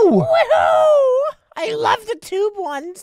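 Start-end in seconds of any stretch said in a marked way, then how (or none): 0.0-1.4 loud high woohoo; excited cheer. Real, not acted. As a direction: whoop with joy. Excited slide ride.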